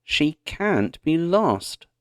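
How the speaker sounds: slow, high insistent Pa, leaning on can't; insisting. A narrator's reading. Pa insisting to Ma that Grace is nearby, slow and firm. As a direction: press the point, can't he be lost. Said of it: "Pa insisted".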